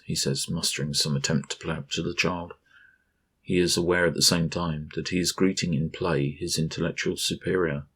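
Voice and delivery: ordinary delivery, nothing marked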